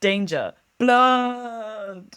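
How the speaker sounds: high, hammed-up spooky warning; theatrical horror warning, played for laughs; mock alarm. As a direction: build to a long, high final word. Reads a creepy drawing's words theatrically.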